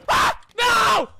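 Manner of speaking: loud scream of dismay. Real, not acted. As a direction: scream as you get caught. Screams as killed.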